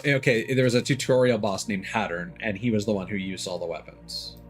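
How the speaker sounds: normal talking voice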